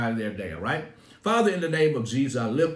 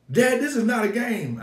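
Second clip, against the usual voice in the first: higher, louder insisting son; upset son; 8 semitones higher, 4 dB louder than usual. Retold or imitated. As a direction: plead it's serious, pitched up.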